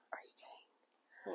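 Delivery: whispered aside; whispering. Real, not acted. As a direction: drop to a breathy whisper. Aside while chatting.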